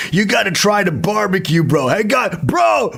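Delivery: mock Texan bro insisting he try the ribs; pushy American bro. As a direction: hype up the barbecue like a frat bro, shouting bro.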